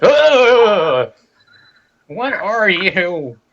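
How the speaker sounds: high, slow disgusted snarl; disgusted wizard. Acted. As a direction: recoil in disgust. GM voices hostile wizard.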